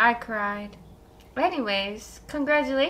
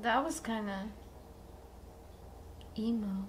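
ordinary talk, nothing marked

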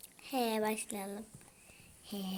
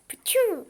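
whispered sound effect; 9 semitones higher, 8 dB louder than usual. Pretend. Imitating a game sound.

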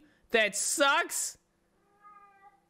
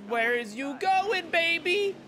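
taunting; 6 semitones higher, slower than usual. Real, not acted. Taunting rival car.

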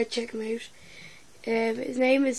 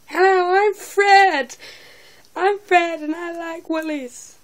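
put-on high, loud, slow squeaky kid voice; a silly high cartoon boy, dragged out; Fred impression; 6 semitones higher, 8 dB louder than usual. Teen impersonating Fred, hammed-up high kid voice. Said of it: "who else could I do".

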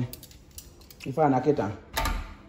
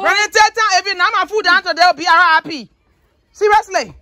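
loud mock threat; 6 dB louder than usual. Real, not acted. Warning Ronnie about food.

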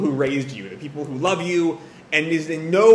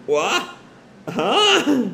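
short question, then a high yelp; freeze, then let the pitch jump in bafflement; frozen disbelief; 7 semitones higher than usual. Acted. Nagi freezing at the mother's crude joke, baffled and high. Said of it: "Nagi's kind of freezing".

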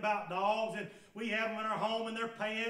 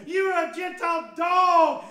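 loud, high shouted accusation; shouted rebuke; 7 semitones higher, 14 dB louder than usual. Acted. Jesus to the Gentile woman, a loud shouted rebuke. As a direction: fling the words out like a verdict.

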